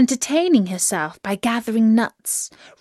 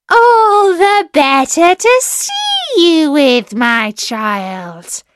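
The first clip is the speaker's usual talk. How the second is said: very high, loud start dropping at the end; slow, crowing disguised wolf; wolf as grandmother; 8 semitones higher, 7 dB louder, slower than usual. A narrator's reading.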